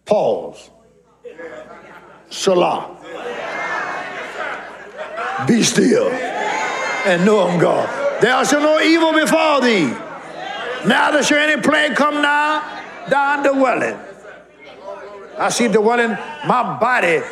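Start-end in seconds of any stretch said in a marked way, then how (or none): 0.1-7.9 low, very slow, calm; hushed stillness. Real, not acted. Preacher quiets the church to be still, slow and low. 8.2-14.0 higher, shouted scripture declaration; fighting voice. Shown as a demonstration. Preacher leads the church in fighting voice. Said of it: "That's a fighting voice".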